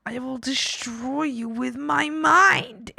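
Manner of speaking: slow, drawn-out mock menace; mock villain threat. Gamer hams up a mind-destroying threat, slowly.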